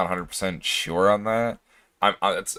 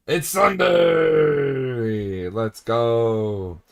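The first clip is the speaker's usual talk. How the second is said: excited cheer; 2 semitones higher, much slower than usual. Real, not acted. Streamer realizes it's Sunday, cheers slowly and happily. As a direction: stretch it out, delighted it's Sunday.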